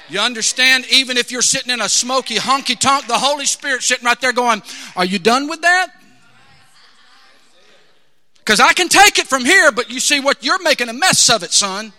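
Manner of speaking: loud, driving preacher's delivery; full-force preaching. Acted. Preacher voicing the Holy Spirit to a sinner, loud and forceful. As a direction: preach it hard, pressing each phrase.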